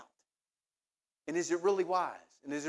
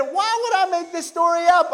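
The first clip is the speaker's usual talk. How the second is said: indignant protest; 14 semitones higher, 14 dB louder than usual. Retold or imitated. Protesting to his family.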